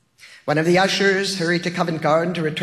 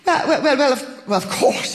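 flustered stammer with a nervous laugh; 7 semitones higher than usual. A narrator's reading. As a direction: trip over the words, laughing nervously. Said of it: "he had stammered".

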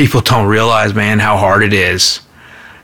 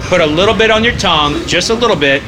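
guiding a tasting; 10 semitones higher than usual. Shown as a demonstration. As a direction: coax the listener to taste it. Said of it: "tasting instruction".

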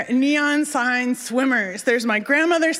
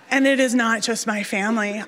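usual speaking voice

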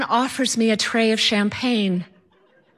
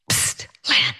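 unvoiced, lower whispered call; stage whisper; 7 semitones lower than usual. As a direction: whisper to her through the curtain. Husband hissing through the curtain.

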